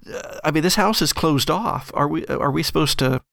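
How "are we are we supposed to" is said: normal talking voice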